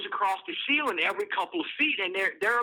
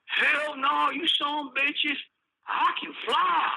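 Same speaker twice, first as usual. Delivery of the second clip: put-on drunk inmate defiance; 7 semitones higher, slower than usual. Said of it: "drunk inmate refusing guards".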